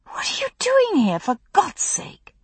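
woman hiding under a tree, fierce and whispered; urgent whisper. A narrator's reading. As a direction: hiss the question so nobody hears. Hidden under the tree, confronting him.

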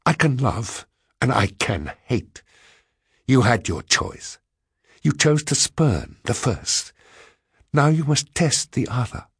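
high-pitched, deliberate defiance; passionate retort. A narrator's reading. Woman warning the man who spurned her, passionate retort. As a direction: declare it with controlled passion. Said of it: "with a passion that equaled my own".

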